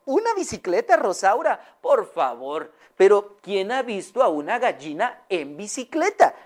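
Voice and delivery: high, incredulous lady's voice; Señora Amelia. Acted. Owner doubting her hen's wish, high and incredulous. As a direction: scoff high in disbelief. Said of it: "se le quedó viendo".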